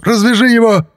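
very high, loud frantic command; wild yell. A narrator's reading. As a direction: yell the order like a man possessed. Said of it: "дурным голосом заорал полицейский". (орать дурным голосом)

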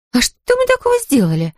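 high, indignant blonde voice; Sandy's voice. Acted. Blonde to the cops, high and indignant.

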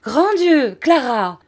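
high, loud horrified outcry; alarmed cry. A narrator's reading. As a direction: cry out sharply as if witnessing disaster. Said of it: "cria Miss Murdstone". (cri d'effroi, aigu et fort)

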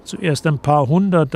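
usual speaking voice

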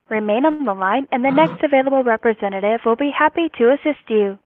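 ordinary talk

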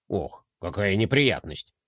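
put-on slow, low sympathetic sigh, French accent; mock sympathy. A narrator's reading. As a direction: sympathize grandly. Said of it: "посочувствовал де Кроль".

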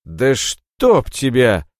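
slow, loud cursing; angry curse. Acted. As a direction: swear in disgust. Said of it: "выругался Мороз".

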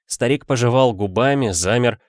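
usual speaking voice

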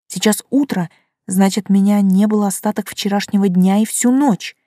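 ordinary delivery

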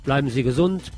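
ordinary delivery

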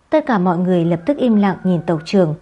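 ordinary delivery, nothing marked

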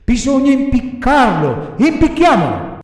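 high, loud villainous cry; villain's verdict. A narrator's reading. One assassin tells the other to hang Pinocchio, loud villain cry. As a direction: proclaim the sentence, climbing to a shout. Assassin proposes hanging the puppet.

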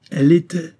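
high, loud, terse retort; curt reply. A narrator's reading. Edward confirms to Esther she was his wife, curtly. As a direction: cut the question short, sharply. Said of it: "répondit Edward Arundel sèchement".

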